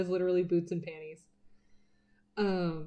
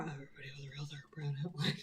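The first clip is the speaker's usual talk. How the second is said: laughing whisper; 4 semitones lower, 8 dB quieter, much faster than usual. Real, not acted. Self-mocking confession while drawing.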